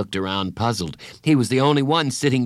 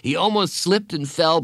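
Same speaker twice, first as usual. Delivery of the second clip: plain reading voice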